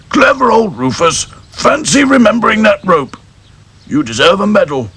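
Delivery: hearty farmer. Acted. Farmer praising his dog, delighted.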